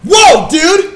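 loud, high mock-alarmed shout; hammed-up macho protest; mock-offended bro. Mock alarm at "I love you.

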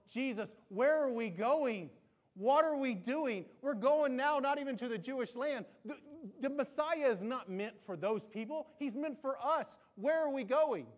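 a disciple demanding answers in dismay; alarmed disciple. Acted. Disciple voiced protesting to Jesus.

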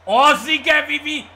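high, very loud burst of praise; real excited cheer. Real, not acted. Gamer praising Vivi's hit, excited cheer. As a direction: shout your approval, pitch jumping up.

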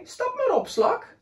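whale inviting. Acted. Whale invites the snail onto its tail, friendly call. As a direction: call the snail aboard kindly.

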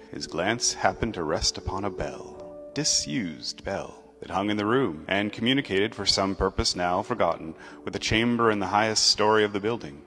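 ordinary delivery, nothing marked

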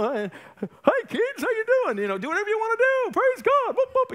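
hammed-up cartoon cheerfulness; mock kids' TV host. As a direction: gush like a children's show dinosaur. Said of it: "He's a big purple dino".

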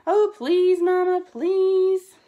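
high, loud begging cub voice; little sister's drawn-out, high-pitched plea; pleading cry. A narrator's reading. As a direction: beg Mama, stretching the final please. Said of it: "cried Sister".

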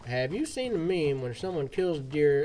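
ordinary delivery

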